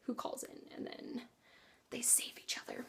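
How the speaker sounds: hushed, unvoiced whisper. Real, not acted. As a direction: breathe the words in a whisper. Whispered plot summary aside.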